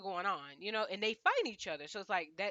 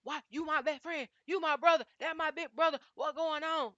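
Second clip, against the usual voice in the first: higher, pleading mock best-friend whine; hammed-up plea to a brother; a light, high imitation of Paris; 7 semitones higher, 5 dB louder than usual. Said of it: "Paris don't talk like that".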